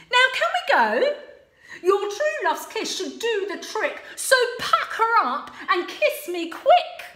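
high, loud and quick; impatient Sue. Acted. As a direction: hurry him along. Sue urging prince.

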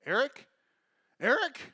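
mock mom calling out, pitch climbing; up an octave. Mom looking for her lost son, high rising call. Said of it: "her voice kinda went up an octave".

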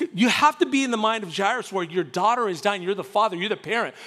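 usual speaking voice

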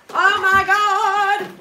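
loud, high, drawn-out amazement; thrilled exclamation. Real, not acted. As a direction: stretch it out in delighted shock. Delighted at a surprise super chat.

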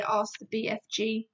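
ordinary delivery

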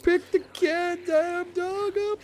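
very high, slow, stretched squeal; mock-exasperated high whine; squeaky exasperated. Real, not acted. Exasperated urging about the dog.

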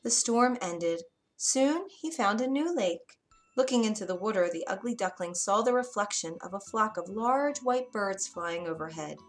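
ordinary delivery

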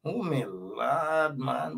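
lightly imitated Guyanese accent, drawn out slowly; meniru aksen Guyana. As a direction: stretch it out like the Guyanese man in the clip. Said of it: "that accent".

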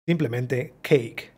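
slow, with Simplemente stressed; slow model. Shown as a demonstration. Teacher slowly models the phrase for students. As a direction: stretch out Simplemente cake. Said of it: "Es la más fácil".

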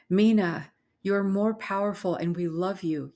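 loud, urgent call to a friend; yelling. A narrator's reading. Idla rallies her friend against the creature, yelling. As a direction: call out strongly, easing toward the end. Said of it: "yelled Idla".

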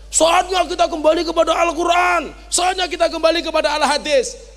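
hammed-up fiery slogan cry; mock preacher shout. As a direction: bellow it like a rally preacher. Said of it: "teriak-teriak seperti itu".

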